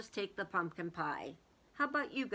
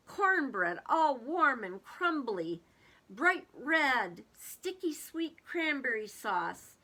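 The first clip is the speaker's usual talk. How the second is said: eager, louder listing of the feast foods; reading to children; 2 semitones higher, 3 dB louder than usual. A narrator's reading. Teacher reads the feast list to children, eager. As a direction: savour each dish for the kids.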